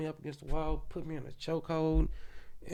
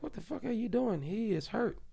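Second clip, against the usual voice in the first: higher-pitched mock nurse, alarmed; nurse's alarmed cry; 8 semitones higher than usual. Nurse yelling at the guard, higher mock alarm. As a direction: imitate the nurse shouting at the guard. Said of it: "She's like".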